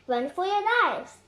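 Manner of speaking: loud high warning cry; frightening shout. Acted. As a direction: yell to send them fleeing. Annie scaring off riders.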